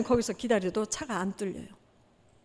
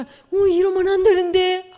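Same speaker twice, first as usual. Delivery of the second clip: worried; 7 semitones higher, 8 dB louder than usual. Retold or imitated. Door won't open, alarm.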